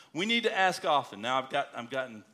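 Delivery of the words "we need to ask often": louder, higher push on need; fervent preaching urge. Acted. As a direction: drive home the call to pray. Exhorting to ask often.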